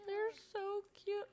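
ordinary talk, nothing marked

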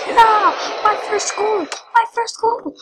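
high, excited goal celebration; real elation. Real, not acted. Player celebrating a first goal, elated. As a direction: shout it high, thrilled to have scored.

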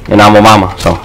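ordinary talk, nothing marked

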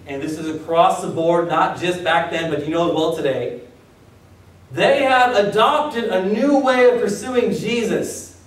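ordinary talk

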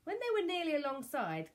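ordinary delivery

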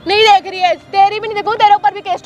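defiant shout. Acted. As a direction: shout it back in his face. Tenant shouts defiantly at the man. (गुस्से में चिल्लाकर)

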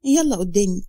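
ordinary delivery

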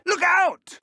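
sudden high, loud cry of alarm; shouted warning. Acted. Professor shouting a warning to the student, alarmed. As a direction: yell a warning as danger strikes.

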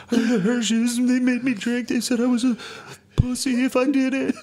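put-on whiny kid excuse. Teen blaming neighbour kids to his mom, high and whiny. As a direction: whine it like a caught kid.

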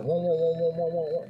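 rapid repeated "mo mo" babble; imitated fussing chatter. Sailor mimicking the agents in uproar, nonsense chatter. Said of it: "Ejen kecoh".

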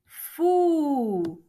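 teacher sounding out a long, emphatic "fuuu"; drawn-out sound. Shown as a demonstration. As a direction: hold the vowel of "fu" for the learner. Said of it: "empieza con".

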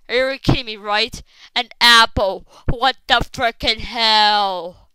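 loud, indignant complaint; loud and fed up; exasperated outburst. Real, not acted. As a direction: raise your voice in outrage at the game. Outraged at getting an apple.

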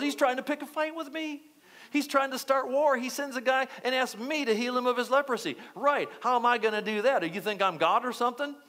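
high, incredulous mock outrage; upset king. King of Israel protests being asked to heal a leper, acted upset. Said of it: "Now the king's really upset".

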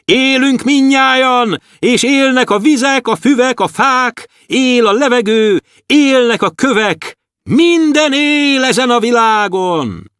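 high, ringing voices of the stars; stars calling back. Acted. Stars shouting back to the dwarf, high. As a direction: answer from the sky, high and loud. Stars proclaim everything lives.

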